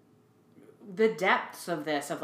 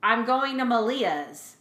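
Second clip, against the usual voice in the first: put-on slow, higher, puzzled child reply; confused voice; 5 semitones higher, 4 dB louder than usual. Young girl answering her mom, confused. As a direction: answer back as a baffled kid. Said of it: "in this very confused voice".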